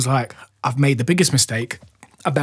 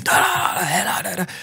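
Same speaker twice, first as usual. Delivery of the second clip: high mock yell; mock shouted babble, pitched up; imitated shouting; 7 semitones higher than usual. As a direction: imitate garbled screaming in a high voice. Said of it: "Bare screaming, shouting".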